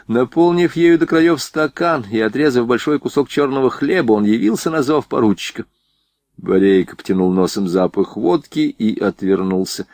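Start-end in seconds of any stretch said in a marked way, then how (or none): none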